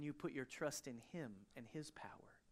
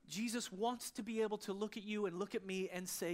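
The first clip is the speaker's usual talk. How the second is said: usual speaking voice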